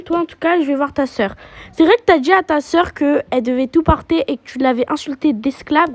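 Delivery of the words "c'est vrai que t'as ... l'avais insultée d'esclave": ordinary talk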